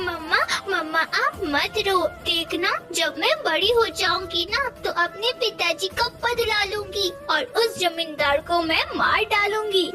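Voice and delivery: determined little daughter. Acted. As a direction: comfort mama and vow like a child. (दृढ़ नन्ही बेटी)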